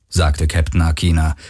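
ordinary delivery, nothing marked

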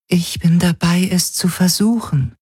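beautiful, high, even voice; an artificial mind reporting in high, level tones; Helix. Acted. The Helix whispers its answer in his head, beautiful even voice.

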